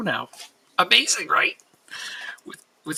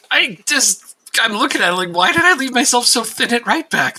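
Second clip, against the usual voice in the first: loud, high exasperated question; exasperated outburst; 7 semitones higher, 6 dB louder than usual. Real, not acted. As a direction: throw up your hands at yourself. Exasperated outburst at own mistake.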